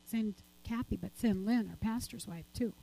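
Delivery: soft, low, hushed, as a divine whisper of instruction; softly voiced divine command; hushed voice of God. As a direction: say it gently, as God speaking quietly. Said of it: "but then God said this".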